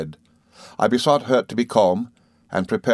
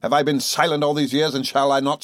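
furious accusation; 6 semitones higher than usual. Acted. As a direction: hurl it at the mother like a curse. Rosa attacking grieving mother.

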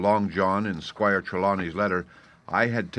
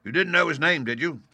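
higher, stern interrogating voice; stern questioning; 8 semitones higher than usual. A narrator's reading. Landlord pressing Morgan about the name, stern. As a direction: press him for an admission. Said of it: "said Long John very sternly".

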